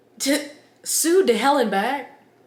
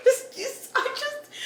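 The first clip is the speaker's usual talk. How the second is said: high breaking laugh through words; 5 dB quieter than usual. Real, not acted. Booktuber cracking up at bad boy golfer, laughing. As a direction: lose it laughing mid-sentence.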